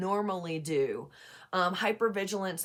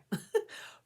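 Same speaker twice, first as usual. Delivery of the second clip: breathy laugh. Real, not acted. Laughs at own frustration.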